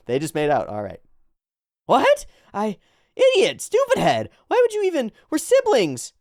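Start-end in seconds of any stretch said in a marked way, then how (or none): 1.9-6.1 high, loud, indignant squawk; outraged brother character. Acted. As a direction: scold a sibling in a shocked, high voice. Brother character in the manga quarrel.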